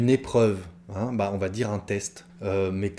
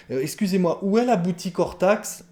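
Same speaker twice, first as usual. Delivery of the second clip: exaggerated prim politeness, pitched higher; mock overly formal request; 8 semitones higher than usual. Shown as a demonstration. Teacher mocking a learner who avoids the anglicism, in a prim high voice. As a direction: ham up the stiff correct phrasing. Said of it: "C'est presque ridicule de parler comme ça".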